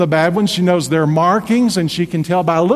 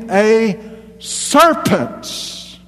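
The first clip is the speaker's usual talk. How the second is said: "serpent." sprung up much higher, suspenseful; dramatic reveal; 5 semitones higher, much slower than usual. Acted. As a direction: hit "serpent." high like a shock reveal.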